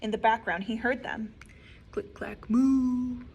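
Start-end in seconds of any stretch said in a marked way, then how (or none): none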